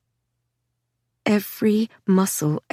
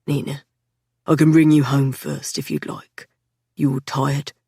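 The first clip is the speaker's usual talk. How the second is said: lightly lowered, gentle man; Patrick's voice; 6 semitones lower than usual. A narrator's reading. Patrick offering Nina a way home, lightly lowered and gentle.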